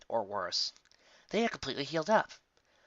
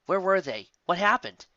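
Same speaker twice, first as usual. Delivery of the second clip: higher, rising panic; panicked; 6 semitones higher, 4 dB louder, slower than usual. A narrator's reading. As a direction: fire off the questions in growing worry. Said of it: "She only grew more panic".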